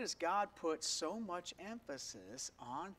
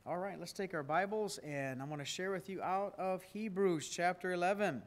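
normal talking voice

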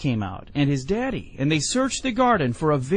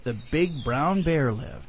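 ordinary delivery

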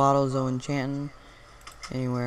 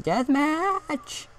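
put-on high, super excited kid voice; kid on christmas morning; 13 semitones higher, 4 dB louder, much slower than usual. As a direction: squeal like an overexcited little kid. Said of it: "some super excited kid".

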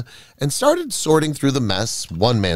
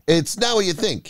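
higher-pitched, pleading protest; mildly panicked excuse, pitched up; defensive cry; 8 semitones higher than usual. A narrator's reading. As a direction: protest as if caught out. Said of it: "he cried".

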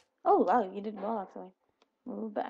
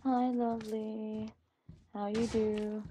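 ordinary delivery

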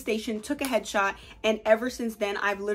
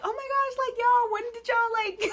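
gushing fan; 12 semitones higher than usual. Pretend. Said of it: "they're like".

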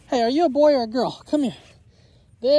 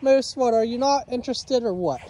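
normal talking voice

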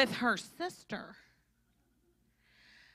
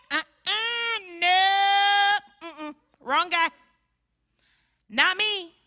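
high, whimpering louder no; frightened denial; 8 semitones higher, 7 dB louder, much slower than usual. Acted. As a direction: deny it in a scared half-cry. Peter denies in fear.

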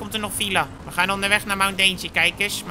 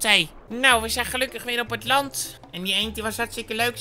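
normal talking voice

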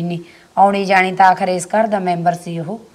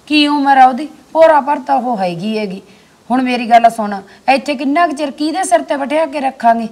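rising indignation, voice pitched up; heated scolding; 6 semitones higher than usual. Acted. To the sister-in-law about the young widow, heated scolding.

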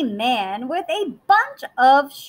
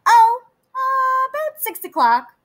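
higher, quick flustered wolf; 12 semitones higher, 4 dB louder than usual. Acted. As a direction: stammer it like a caught wolf. Wolf caught off guard.

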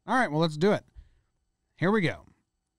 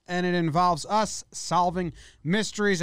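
ordinary talk, nothing marked

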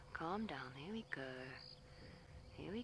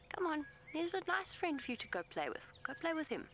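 much higher, sing-song coaxing; 11 semitones higher than usual. Real, not acted. Guide urges the elephant to go play, high coaxing voice. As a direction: sweet-talk him toward his friend.

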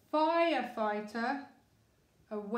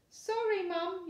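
yelled sorry; 8 semitones higher than usual. A narrator's reading. Daughter apologising to mum, high yell. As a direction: call out in a rush like a girl. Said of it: "yelled Laura".

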